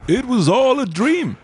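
high, drawn-out dramatic line; joking dream-twist voice, slow and high; theatrical mock reveal. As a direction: sail way up high and stretch it out, hammed-up. Listener's theatrical joke interjection.

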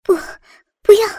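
short, urgent refusal; halting cry of refusal; resisting. A narrator's reading. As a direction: protest in alarm. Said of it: "林燕抗拒".

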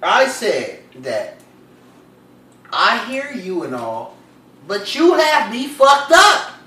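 loud, deliberate oration with long pauses; emphatic, performed outrage; indignant declaration. Acted. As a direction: project each phrase like a formal rebuttal. Indignantly reciting his rebuttal message.

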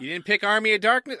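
thick Boston accent, dropped Rs; Massachusetts accent. Real, not acted. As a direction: say it like a Boston local. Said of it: "I said it first in my Massachusetts accent".

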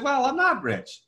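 high, slow sheepish protest; audience member. Retold or imitated. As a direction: lightly voice a doubtful attendee. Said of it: "They said".

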